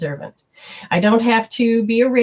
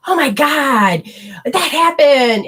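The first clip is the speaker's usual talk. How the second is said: higher, louder, slowed mock freakout; mock panic; 6 semitones higher, 5 dB louder, slower than usual. To viewers, imitating the old negative reaction, mock panic. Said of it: "we can do the old way of".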